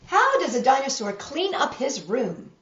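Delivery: storybook reading voice; reading to children. A narrator's reading. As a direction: read it like a bedtime picture book. Reads picture book to children.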